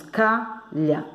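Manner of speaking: CALHA split into two syllables. Shown as a demonstration. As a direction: pause between the halves of "calha". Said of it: "sílaba complexa". ("calha" separado em duas sílabas)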